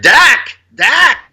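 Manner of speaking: high-pitched mock yell of a name; imitated yell. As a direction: imitate someone yelling back to his co-pilot. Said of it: "he keeps yelling back".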